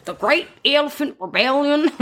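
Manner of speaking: mock Southern storyteller; Southern US accent. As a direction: announce it grandly in a Southern accent.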